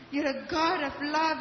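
ordinary delivery

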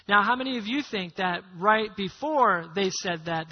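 usual speaking voice

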